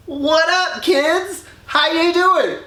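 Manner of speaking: high, bright put-on greeting to the kids; mock high greeting. Cronus greeting the kids before eating them, high mock voice. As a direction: chirp it up high like a fake-friendly dad.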